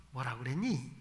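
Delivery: softly and low, with a smile; a mother turning from the TV to ask her child, softly smiling; gentle smiling question. Shown as a demonstration. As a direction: ask your child kindly, face to face. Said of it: "웃으면서 그래".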